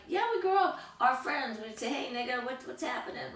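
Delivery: imitating friends. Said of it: "friends are like".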